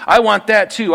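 mock covetous shout; greedy demand. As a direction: push the want harder, nearly whining. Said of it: "and like".

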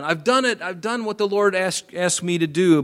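high, eager self-satisfied claim; Saul boasting. Retold or imitated. Saul boasting to Samuel, high and eager. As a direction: proudly insist he obeyed. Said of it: "So now Saul is going".

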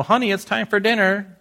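lightly voiced wife calling to dinner; high sing-song call to dinner; a hint of a wife calling out.

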